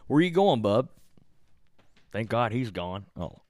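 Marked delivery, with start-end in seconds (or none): 0.1-0.9 high, louder call after someone; calling to bub. Real, not acted. Calling after bub as he goes, high-pitched call.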